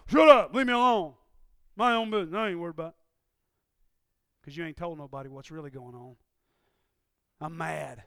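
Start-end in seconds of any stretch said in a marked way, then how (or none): 0.1-3.0 louder, angry snap; angry brush-off. Retold or imitated. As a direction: snap at the wife to back off. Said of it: "you just say".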